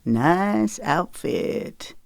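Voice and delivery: slow, hammed-up country drawl; exaggerated country twang. Teasing her about her outfit in an exaggerated country twang. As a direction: stretch it out in a mock country twang. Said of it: "he drawled in an exaggerated country twang".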